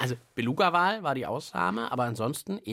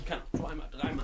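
breathless whisper; 2 semitones higher, 6 dB quieter, much faster than usual. Real, not acted. Hopping backwards, out of breath.

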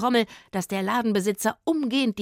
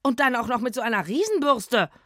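put-on higher, louder outraged boy voice; indignant; 6 semitones higher, 5 dB louder than usual. Boy huffing to his friends about the shopkeeper, outraged. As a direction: huff at the shopkeeper's cheek. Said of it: "empörte sich Rufus".

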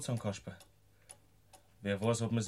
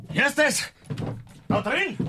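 much higher, shouted challenge; loud calling out; 13 semitones higher, 7 dB louder, slower than usual. Acted. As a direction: yell into the dark at the hidden foe. Calls out at hidden devil.

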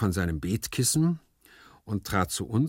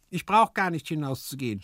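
higher, sulky servant voice; sullen; 8 semitones higher than usual. A narrator's reading. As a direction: grumble back at the master. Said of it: "sprach dieser verdrießlich". (verdrießlich, in höherer Stimme)

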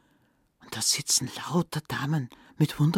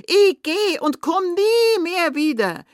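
very high, loud, drawn-out shriek; furious scream; 13 semitones higher, 7 dB louder than usual. A narrator's reading. Stepmother screams at the returning children, furious. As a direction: shriek it in a storybook rage. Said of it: "hat wütend rausgeschrien".

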